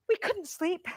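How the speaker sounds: nervous suspense. Acted. Suspenseful night in the tent.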